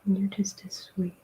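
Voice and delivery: tender soft whisper; whispering to cat. Real, not acted. Owner whispering tenderly to her cat. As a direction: murmur sweet nothings.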